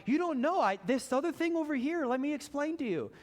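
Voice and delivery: high, rushed defensive protest; defensive excuse. Retold or imitated. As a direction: talk fast to justify yourself. Defending own driving mistake.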